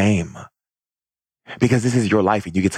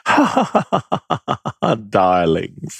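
laughing opera diva. Retold or imitated. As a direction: imitate a grand aunt laughing merrily. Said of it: "laughed at everything with a".

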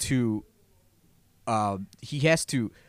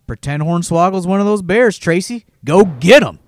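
rousing shout; 6 semitones higher, 9 dB louder than usual. Retold or imitated. S0 fires up Tracy, recalled.

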